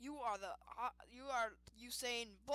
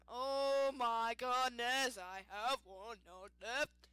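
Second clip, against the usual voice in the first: drawn-out dismay; 7 dB louder, much slower than usual. Real, not acted. Panicked, low on health.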